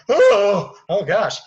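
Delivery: loud, high gasp of fright; startled gasp. Acted. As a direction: jump at the sight of her. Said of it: "so she's like".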